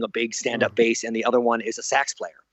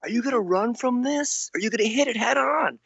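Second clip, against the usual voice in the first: higher, pointed challenge as the wife; a hint of the wife through the window; challenging wife; 10 semitones higher than usual. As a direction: play the wife daring him to face it.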